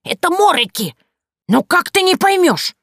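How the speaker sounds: put-on old witch losing patience; exasperated Yaga. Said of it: "раздражаясь".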